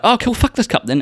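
high-pitched mock outburst; sudden anger. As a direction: imitate snapping at an object, high and sarcastic. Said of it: "I just got so angry so quick".

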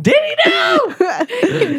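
high squealing laugh through words; laughing shriek. Real, not acted. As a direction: shriek it while cracking up.

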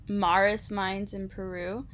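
ordinary talk, nothing marked

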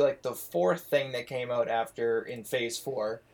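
ordinary talk

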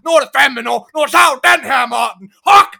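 high, loud, fast mock shouted order; grandmother shouting. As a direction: bark the command like a fed-up grandma. Grandmother ordering boy to chop.

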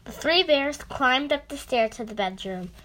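plain reading voice

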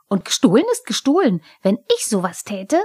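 quick aggrieved comeback; indignant kobold. A narrator's reading. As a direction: argue back hotly as a little kobold.

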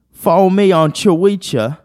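put-on high British accent, "Twitter" misread as "cho-wee-cha"; mock British accent. Puzzling out a British meme, mock British accent. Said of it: "British people be like".

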